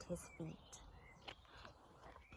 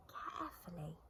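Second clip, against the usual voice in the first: much softer echo of carefully; softly repeated; 2 semitones lower, 7 dB quieter than usual. A narrator's reading. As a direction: hush it down, tiptoeing.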